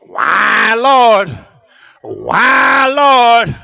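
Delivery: loud crying out to God; mock lament why Lord; anguished cry. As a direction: plead with heaven in pain. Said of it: "and I'm thinking".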